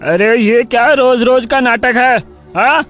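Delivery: irate man berating someone; angry scolding. A narrator's reading. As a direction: scold a beggar and chase them off. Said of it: "लाली पर गुस्सा होकर उसे डांटने लगता है".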